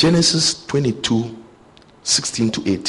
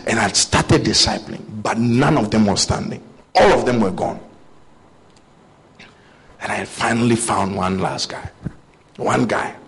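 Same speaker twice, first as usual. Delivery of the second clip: ordinary talk, nothing marked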